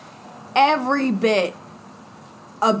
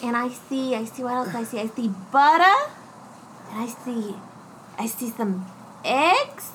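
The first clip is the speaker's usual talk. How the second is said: mystic mind reader; 2 semitones higher, 4 dB quieter than usual. Retold or imitated. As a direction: intone it like a seer. Imitating Kitty's mind reading act.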